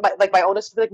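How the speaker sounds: normal talking voice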